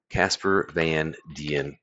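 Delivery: each word slowly spaced out; slow, careful spelling-out. Real, not acted. Streamer giving viewers a name to find, slow and deliberate. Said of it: "as spelled thusly".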